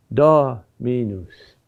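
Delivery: Dominus with long DO, slight break, then minus; dactyl stress. Shown as a demonstration. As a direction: stretch DO slowly and set it apart in Dominus. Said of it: "is the Greek for a finger".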